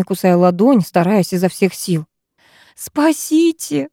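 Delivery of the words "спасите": a high, drawn-out cry for rescue; much higher, slow cry of terror; shriek. A narrator's reading. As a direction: shriek for rescue, stretched long. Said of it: "оглушающе завизжала".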